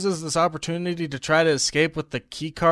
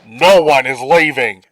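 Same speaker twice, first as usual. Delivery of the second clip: loud barked order; forceful put-on military voice; mock soldiers; 3 semitones lower, 10 dB louder than usual. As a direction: parody a guard laying down the law. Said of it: "the soldiers are like".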